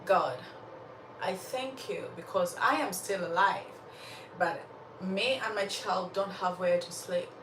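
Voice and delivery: soft, subdued prayer demonstration; sincere prayer. Shown as a demonstration. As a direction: pray humbly and calmly, asking for help. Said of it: "I'll show you a prayer".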